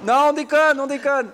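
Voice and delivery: high, loud call breaking into laughter; joking shout. Pretend. Host calls to listeners that it's a joke, laughing shout.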